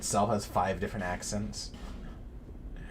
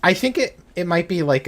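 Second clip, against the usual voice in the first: excited; 9 semitones higher, 11 dB louder than usual. Real, not acted. Sudden loud rise.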